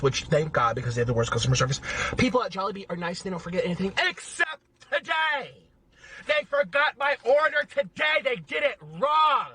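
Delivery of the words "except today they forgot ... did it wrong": complaining about a forgotten order, rising to a shout; rising outraged complaint. Real, not acted. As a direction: pitch climbs to an outraged yell.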